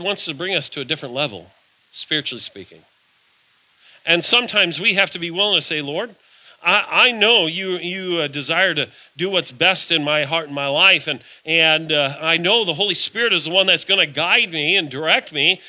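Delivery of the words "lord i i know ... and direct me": a prayer spoken louder and rising with fervour; fervent prayer. Acted. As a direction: lift it to God with growing urgency.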